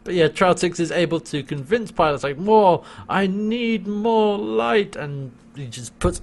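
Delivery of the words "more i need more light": high, slow, drawn-out craving; hammed-up imperious demand; mock demanding. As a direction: draw out each word greedily. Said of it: "demanding more light from Pilot".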